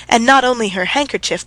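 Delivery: plain reading voice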